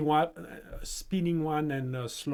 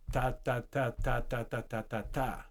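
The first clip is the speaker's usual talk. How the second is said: exponential rhythm, softer; 3 semitones lower, 6 dB quieter than usual. Shown as a demonstration. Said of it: "Same thing".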